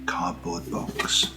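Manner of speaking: ordinary talk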